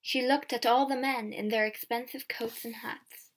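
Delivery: ordinary delivery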